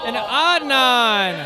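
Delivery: winner's name stretched long and falling; slow, drawn-out name call; victory call. Real, not acted. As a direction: draw the name out like a ring announcer. Calling the first fight win.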